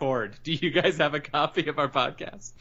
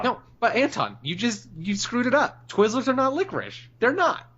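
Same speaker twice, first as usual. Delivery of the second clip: much higher, insistent protest; indignant correction; 8 semitones higher than usual. Real, not acted. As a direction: correct him, voice climbing. Insisting Twizzlers are not licorice.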